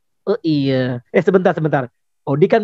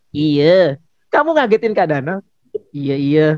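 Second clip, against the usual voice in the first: ordinary talk, nothing marked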